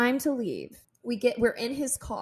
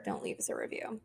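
whispered plea to Buster, soft; whispered joke; 10 dB quieter than usual. Real, not acted. Joking aside to Buster.

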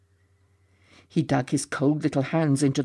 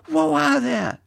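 high cry of whoa; shouting; 11 semitones higher than usual. A narrator's reading. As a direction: shout after a runaway horse. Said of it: "shouted Ginty".

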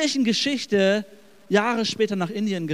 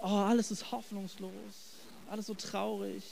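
much softer, hopeless mock moan; gloomy pessimist; 11 dB quieter than usual. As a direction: lightly play a gloomy doom-monger. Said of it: "der Schwarzmaler überhaupt".

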